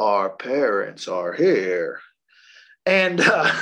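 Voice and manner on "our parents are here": put-on low, slow, eerie child voice; drawn-out haunted child; creepy kid. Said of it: "they're like".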